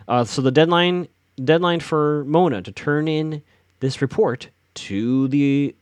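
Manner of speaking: usual speaking voice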